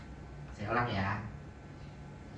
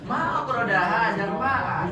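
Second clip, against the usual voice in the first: higher, slower model phrase; Arabic model; 6 semitones higher than usual. Shown as a demonstration. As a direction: say it proudly in Arabic.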